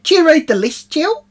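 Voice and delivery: high, loud bossy demand; scolding shout. Retold or imitated. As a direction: bark it like a strict house mum. Bossy mother scolding housemate.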